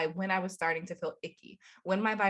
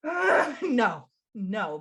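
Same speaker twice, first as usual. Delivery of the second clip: exasperated; 3 dB louder, much slower than usual. Retold or imitated. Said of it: "we were like".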